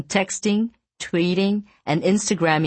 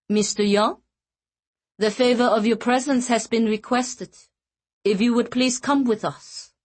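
put-on high, formal Norwegian accent; thick Norwegian accent; 7 semitones higher than usual. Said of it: "in a thick Norwegian accent".

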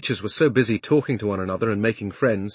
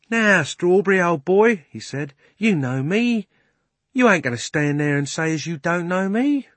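higher, louder London cabman; cockney cabby; 8 semitones higher, 4 dB louder than usual. Acted. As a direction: coax the old horse.

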